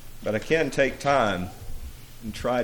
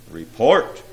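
louder, firm order; a touch of thunder in the command; 11 dB louder than usual. A narrator's reading. Demon prince demands news from silent imps, mildly thundered. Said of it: "the prince thundered".

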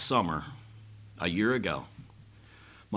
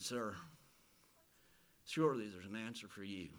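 much softer, low, pleading calm; gentle reassurance; 3 semitones lower, 14 dB quieter than usual. Retold or imitated. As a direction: soothe him quietly. Calming a suicidal man.

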